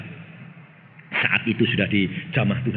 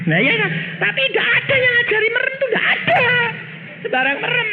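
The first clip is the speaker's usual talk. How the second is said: high, fervent preacher's cry; preaching at full force; 13 semitones higher, 3 dB louder, faster than usual. Acted. Preacher mocks closed-eye prayer, fervent and high. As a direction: preach it hard to the congregation.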